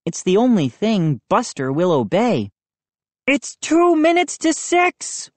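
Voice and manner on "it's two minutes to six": high, taunting countdown; Moose warning. A narrator's reading. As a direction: warn with glee. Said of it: "Moose warned".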